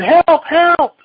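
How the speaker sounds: high, loud cry for help; hammed-up little chick's alarm call; mock panic. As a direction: squeal it out like a scared chick. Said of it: "Chicken Little said".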